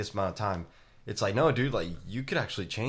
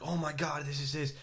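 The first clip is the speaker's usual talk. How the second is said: mocking someone freaking out, pitched higher; hammed-up overreaction; a light parody of a stressed person; mock panic; 6 semitones higher than usual.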